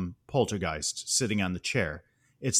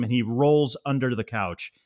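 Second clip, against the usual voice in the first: ordinary talk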